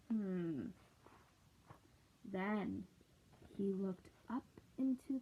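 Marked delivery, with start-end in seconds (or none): none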